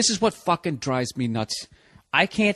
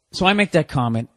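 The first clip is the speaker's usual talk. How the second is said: usual speaking voice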